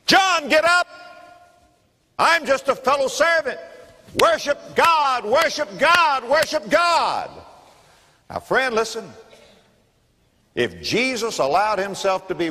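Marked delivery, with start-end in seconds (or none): 0.0-7.3 high, urgent angel voice; angel rebuking. Acted. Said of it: "Here's an angel saying".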